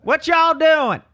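put-on very high, loud country-accent holler; mimicked home accent. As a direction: stretch every word in a mock country accent. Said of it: "this is your accent".

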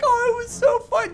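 mock cheerful parent. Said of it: "They're like".

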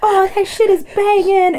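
high, slow fangirl gush; Mozart-era fangirl. Retold or imitated. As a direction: gush like a swooning fan. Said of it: "they were just like".

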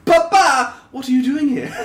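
loud, high French-style Papa! then trailing into English; mock French Belle. Imitating Belle's brief French accent, hammed-up call. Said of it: "was in a French accent".

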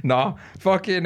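high burst of laughter, then slow words; giggling refusal to cry on camera; real laughter. Real, not acted. Teased about crying on camera.

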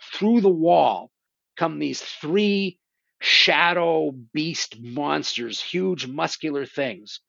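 slow, ominous storytelling; slow dramatic build. Retold or imitated. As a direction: draw it out for suspense. Dramatic build of monster entrance.

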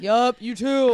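loud breezy yup; resigned. Retold or imitated. Giving in to strangers who say sir, loud breezy reply. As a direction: go along with it. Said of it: "I'm just like".

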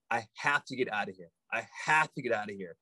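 low, quick and quiet resolve; hushed, rapid, definite statement; determined. Retold or imitated. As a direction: imitate someone firmly set on leaving, no screaming. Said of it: "He was so determined. He wasn't screaming".

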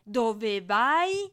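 loud angry shout; furious yell. A narrator's reading. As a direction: yell it at him in fury. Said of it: "urlò Not furente". (urlo furioso)

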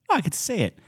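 put-on high, excited young waitress voice; chirpy new waitress, eager.

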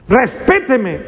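high, mock-indignant demand; exigencia paterna imitada. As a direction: act a parent demanding respect, pitch jumping up. Papá imitado exige respeto. (orden indignada fingida, aguda)

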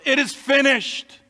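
high, drawn-out proclamation; triumphant shout. Acted. Preacher quoting Jesus' triumphant final words, acted shout. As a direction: call it out slowly, pitched well up. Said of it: "and he shouts first".

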